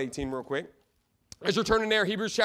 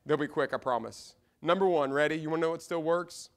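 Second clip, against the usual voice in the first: ordinary talk, nothing marked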